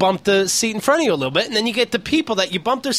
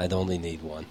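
softer, solemn repeat of the punchline; hushed mock speech; 12 dB quieter than usual. Shown as a demonstration. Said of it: "That'd be my presidential speech".

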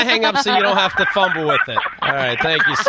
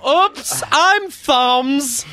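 mock ditzy girl; 4 semitones higher, much slower than usual. Mocking a clumsy girl.

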